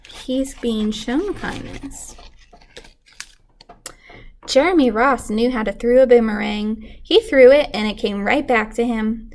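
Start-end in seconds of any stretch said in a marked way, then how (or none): none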